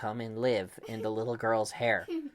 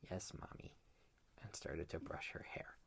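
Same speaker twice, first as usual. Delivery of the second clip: very soft, meek whisper; 5 semitones lower, 16 dB quieter than usual. A narrator's reading. Girl obeying her mommy, meek whisper. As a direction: whisper it humbly.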